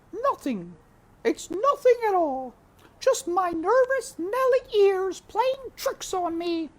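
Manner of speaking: put-on high, loud voice of a fussy old cook. A narrator's reading. Cook voiced in read story.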